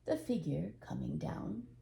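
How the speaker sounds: ordinary delivery, nothing marked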